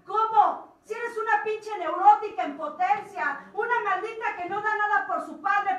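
loud, harsh self-reproach; self-scolding rant. Retold or imitated. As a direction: tear into yourself, loud. Se regaña a sí misma. (regañándose a sí misma con furia)

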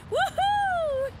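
high, loud whoop of joy; excited whoop. Acted. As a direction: cheer it out like you won. Thruster finally ignites.

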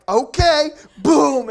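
sudden loud, high burst; abrupt retort. Retold or imitated. Mom promptly spanks the brother too, sudden loud burst.

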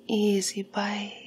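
ordinary talk

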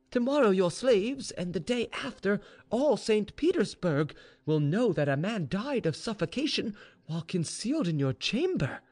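high, emphatic pleading voice; earnest maid, warning. Acted. Maid warns mistress of dishonour, urgent and emphatic. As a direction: drive the danger home.

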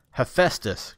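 name called out loud and fierce; angry summons of a lagging god; fierce cry. A narrator's reading. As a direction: bark the name at him. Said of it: "cried Kratos fiercely".

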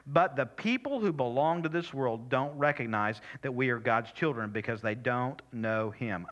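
ordinary talk, nothing marked

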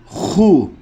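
hu with a scraped, rasping kh. Shown as a demonstration. Showing learners the rasped letter, rasping hu. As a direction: rasp the h of hu in the throat. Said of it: "kırıltılı".

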